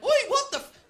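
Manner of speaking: high, loud outraged yelp; startled shout. Retold or imitated. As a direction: yell in shock at the grope. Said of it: "I went".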